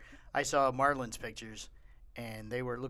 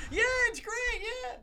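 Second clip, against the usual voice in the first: much higher, hammed-up fake cheer; sarcastic mock; slower than usual. Mocking people blinded by his lights, sarcastic. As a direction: gush it with heavy sarcasm.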